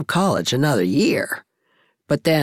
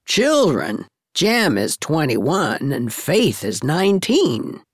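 lower-pitched, pointed comeback; retort; 4 semitones lower than usual. A narrator's reading. Miss Cornelia answering Susan's objection, as a retort. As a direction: throw it back at her, voice lowered. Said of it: "retorted Miss Cornelia".

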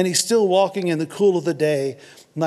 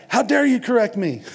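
higher, offended outburst; mock indignation; 6 semitones higher, 3 dB louder than usual. Voicing someone mad at getting caught, mock indignation. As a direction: play the defensive person, affronted. Voices a defensive person who got caught.

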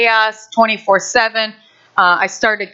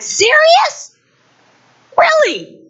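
high, incredulous mock outrage; exasperated disbelief; slower than usual. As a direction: throw up your hands in disbelief. Mother exasperated at early risers.

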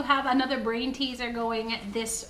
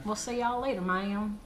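put-on awful Southern accent. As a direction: fake a Southern goodbye, badly. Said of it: "That was awful".

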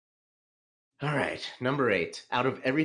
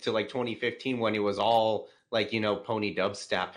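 normal talking voice

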